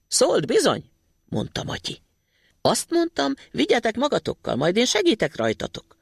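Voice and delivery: high, chirpy little voice; Thumbling calling from the ground; tiny boy voice. Acted. Matyi's little voice.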